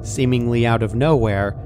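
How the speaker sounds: ordinary delivery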